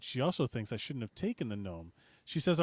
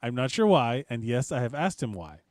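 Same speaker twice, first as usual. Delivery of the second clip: ordinary talk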